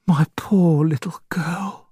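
whispered through tears; broken whisper. Acted. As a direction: choke out a grieving whisper. Grieving father.

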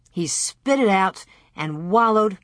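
ordinary delivery, nothing marked

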